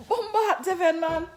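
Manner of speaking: real high, loud call. Real, not acted. Mother calls her child back, loud and high.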